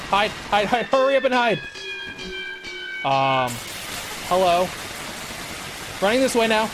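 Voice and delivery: panicked high yelling; being chased. Real, not acted. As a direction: scream in panic while fleeing. Fleeing killer nun in game.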